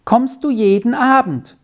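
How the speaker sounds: put-on high, hopeful boy's plea; pleading child's question. A narrator's reading. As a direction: ask it as an entreaty, pitched well up. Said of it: "fragte Beni bittend".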